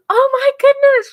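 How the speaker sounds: hammed-up delighted gasp of a sweet cashier; gushing cashier. Retelling the cashier's delighted reaction.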